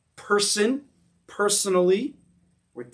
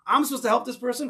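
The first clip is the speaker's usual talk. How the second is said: incredulous; 8 semitones higher, faster than usual. Shown as a demonstration. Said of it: "emphasized the difficult parts purposely".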